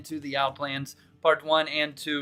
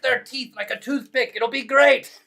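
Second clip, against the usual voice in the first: high-pitched, shouted raid alarm; mock battle cry; 11 semitones higher, 5 dB louder than usual. Streamer arming chat for a mock raid, frantic battle shouting.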